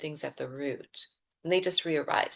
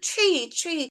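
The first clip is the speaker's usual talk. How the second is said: angry labeling; 10 semitones higher, 6 dB louder than usual. Retold or imitated. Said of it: "Because we're filled with anger".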